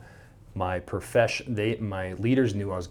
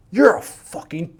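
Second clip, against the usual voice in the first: angry retort; 10 semitones higher, 10 dB louder than usual. Retold or imitated. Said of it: "He said".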